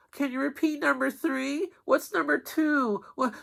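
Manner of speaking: put-on a squeaky whiny child; imitating kids. Kids pestering.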